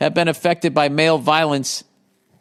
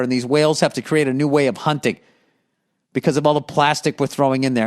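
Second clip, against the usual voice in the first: ordinary talk, nothing marked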